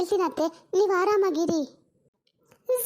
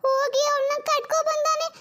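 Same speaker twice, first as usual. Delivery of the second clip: slow, higher, tearful lament; weeping complaint; 5 semitones higher than usual. Acted. Mother-in-law lamenting her son's secret marriage, slow tearful wail. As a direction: cry out the grievance about her son. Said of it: "ಯಾಕೆ ಹಿಂಗ್ ಅಳ್ತಿದ್ದೀರಾ?".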